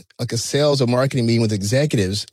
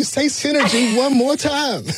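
high, slow menacing mock dare; hammed-up gangster threat over buzzwords; mock tough dare; 13 semitones higher, slower than usual. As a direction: draw out the dare like a movie gangster. Pulp Fiction dare parody.

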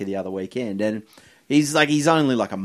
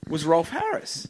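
ordinary talk

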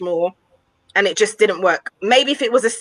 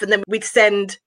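usual speaking voice

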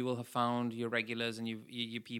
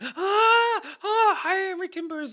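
high, loud shrieking cries from a sinking ship; imitated panicked screams; 9 dB louder, slower than usual. Mocking an ASMR ocean track with loud high drowning screams. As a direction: shriek like someone drowning at sea. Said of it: "And it's like".

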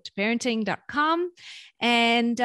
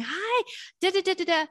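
high, bright-eyed hi da da da; mock delighted parent greeting; adoring greeting; 8 semitones higher than usual. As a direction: gush over the child as they walk in. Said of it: "then going".

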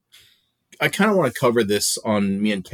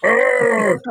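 giant's booming roar, higher and louder; Andre the Giant voice; 11 semitones higher, 5 dB louder than usual. Pretend. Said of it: "in the Fezzik voice, which is the Andre the Giant voice".

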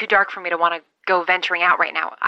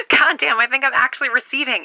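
higher, laughing with disbelief; excited laugh; 6 semitones higher, 3 dB louder than usual. Acted. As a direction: burst out laughing in relief. Signal finally comes through.

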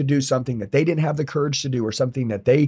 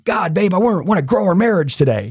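higher, mock-earnest husband voice; 5 semitones higher, 4 dB louder than usual. Husband mocking the ideal he never was, raised voice. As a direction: ham up a devoted husband. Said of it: "I'm not going home ... and going".

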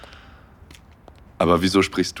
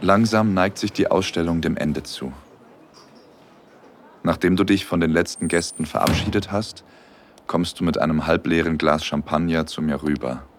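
intimate story narration. A narrator's reading. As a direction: tell it gently like a romantic audio story. Intimate second-person story narration.

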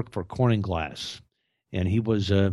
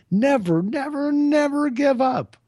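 much higher and louder, slow rallying cry; shouted never; 4 dB louder than usual. Real, not acted. Climax urging listeners never to quit, high slow shout. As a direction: belt it out like a battle cry.

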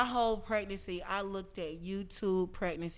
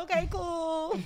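higher, light and easy; relieved okay; 9 semitones higher, 3 dB louder, slower than usual. Retold or imitated. Answering her friend after being forgiven, relieved. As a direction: shrug it off happily. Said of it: "I was like".

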